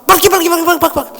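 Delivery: high, loud, fast "pergi, pergi"; contemptuous shooing. Retold or imitated. Man snaps at the garden cleaner to go away, high and loud. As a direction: shoo the lowly gardener away with scorn.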